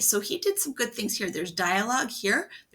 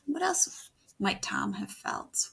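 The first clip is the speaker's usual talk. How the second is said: usual speaking voice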